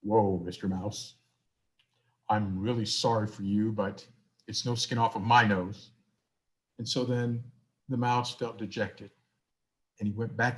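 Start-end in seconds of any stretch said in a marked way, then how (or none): 0.0-5.9 low cow voice. Acted. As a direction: drop your voice down like a cow. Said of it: "that's what the cow would sound like".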